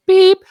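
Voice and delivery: a sharp high loud beep; imitated beep. Mimicking a voicemail tone between his messages, a high loud beep.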